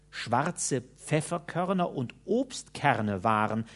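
ordinary delivery

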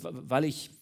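stuck on the first sound, repeating it; stuttering. A narrator's reading. Naked minister stutters his excuse to the commissioner. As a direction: stutter helplessly before getting it out. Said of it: "Der andere stotterte".

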